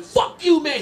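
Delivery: retold angry yell. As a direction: yell it at the cabbie. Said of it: "I start yelling".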